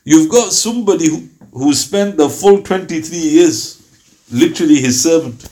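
ordinary talk, nothing marked

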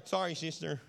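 put-on mumbled grudging kid apology; forced sulky apology. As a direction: say sorry without meaning it.

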